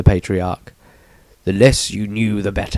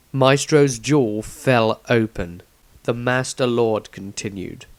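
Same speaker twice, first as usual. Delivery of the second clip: ordinary delivery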